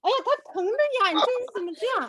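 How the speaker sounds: high, loud, fast protest; scolding. Real, not acted. Teacher scolding someone for hurting the pet, exasperated. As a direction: cry out in reproach.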